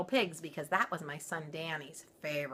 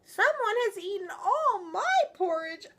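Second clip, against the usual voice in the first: much higher, louder whimpering little bear; sad baby bear; 8 dB louder than usual. Acted. Baby Bear crying his porridge is gone, high. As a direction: wail it like a tiny sad cub. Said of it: "baby bear sadly".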